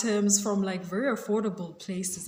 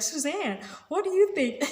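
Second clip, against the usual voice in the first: playful imitation of the host, pitched up; imitating Wendy; 9 semitones higher than usual. Wendy turning to Suzanne on air, mock host voice. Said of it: "turn to her and be like".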